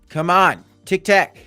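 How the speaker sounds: normal talking voice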